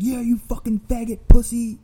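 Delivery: high, loud imitated taunt; angry insult. Old guy hurls slurs at cashier, loud angry shout.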